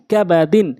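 short vowels, syllables run together; quick reading. Shown as a demonstration. Teacher showing how it must be read, quickly. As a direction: read it briskly as one word. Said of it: "দ্রুতটা উচ্চারণ করতে হবে".

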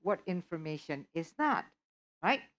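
usual speaking voice

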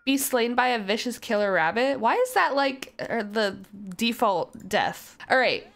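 ordinary talk, nothing marked

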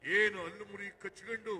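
normal talking voice

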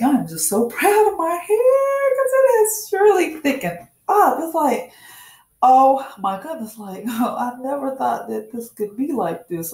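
thrilled high-pitched gushing; excited about hair. Real, not acted. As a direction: gush about your hair like you can't believe it. Proud of thickened hair.

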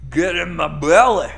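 loud, high hammy roar; get in my belly shout. Pretend. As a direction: bellow it like a greedy movie glutton. Quoting a famous movie line.

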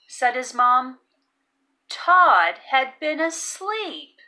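ordinary delivery, nothing marked

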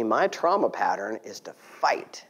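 ordinary talk

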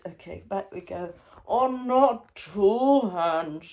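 normal talking voice

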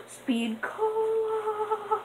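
very high, slow, stretched-out Speed Cola; stretched, high squeal of the name; excited exclaim. Real, not acted. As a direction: announce the find with delight. Spotting the Speed Cola perk.

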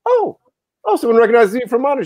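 high, fast, delighted surprise; excited surprise. Retold or imitated. Recognized outside by a fan, high excited gush. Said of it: "I'm like".

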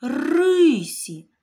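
Рисі with РИ loud and high; stress on first syllable. Shown as a demonstration. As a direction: punch РИ in Рисі. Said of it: "куди падає наголос".

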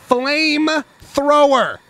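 loud, slow, high flamethrower; excited. Real, not acted. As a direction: belt out flamethrower with glee. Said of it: "A flamethrower".

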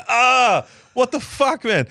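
high-pitched mock outrage; disgusted protest. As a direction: re-enact a disgusted outburst, pitched right up. Retelling being drooled on.

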